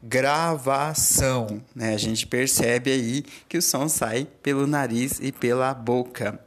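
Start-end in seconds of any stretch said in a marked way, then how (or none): 0.0-1.6 gravação said slowly, stretching the nasal ão through the nose; nasal demo word. Shown as a demonstration. Teacher shows students the nasal sound, slow nasal demo. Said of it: "coloque a mão no nariz e diga".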